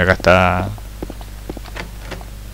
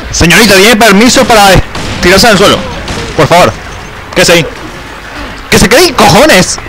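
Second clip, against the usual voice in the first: shouting over music; 10 semitones higher than usual. Real, not acted. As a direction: yell over a blaring soundtrack. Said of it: "Se me estoy gritando por el soundtrack".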